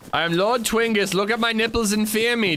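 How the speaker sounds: high, grandiose bunny villain; pompous rabbit lord. Acted. Bunny lord demanding to be feared, grandiose. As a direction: proclaim yourself a fearsome lord in a high voice.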